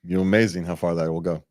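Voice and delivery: ordinary delivery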